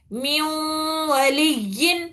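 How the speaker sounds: میو ولیین drawn out slowly with a nasal hum; slow nasal recitation. Shown as a demonstration. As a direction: recite it slowly through the nose. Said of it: "ایسا پڑھنا ہے اس کو".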